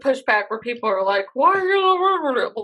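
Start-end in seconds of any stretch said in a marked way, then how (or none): 1.3-2.5 high, very slow mock complaint sliding into gibberish; sing-song mangled whining; Swedish Chef garble. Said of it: "Can you do your Swedish Chef".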